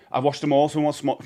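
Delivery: ordinary talk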